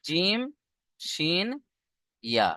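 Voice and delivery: জিম, শিন, ইয়া separated by pauses; mid-tongue letter demo. Shown as a demonstration. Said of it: "জিভের মাঝখান তার বরাবর".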